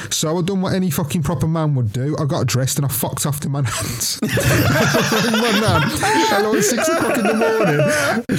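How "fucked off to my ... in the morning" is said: breaking into high-pitched laughter; laughing while talking. Real, not acted. Friend confesses fleeing to his nan's, laughing through it. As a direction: let the laugh overtake the sentence.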